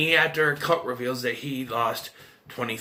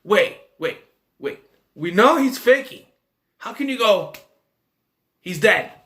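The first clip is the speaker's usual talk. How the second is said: raised, higher, louder voice in disbelief; higher, louder wait-what protest; incredulous protest; 4 semitones higher, 4 dB louder than usual. Retold or imitated. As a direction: stop them, you can't be serious. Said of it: "I'm like".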